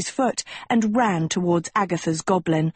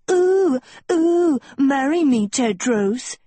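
high, loud, swooning mockery; mocking imitation; 7 semitones higher, 3 dB louder, slower than usual. A narrator's reading. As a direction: mimic a lovesick girl with exaggerated oohs. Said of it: "hissed Agatha".

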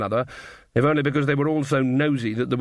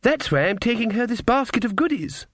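put-on high, innocent child voice; sweet little girl; 10 semitones higher than usual. A narrator's reading.

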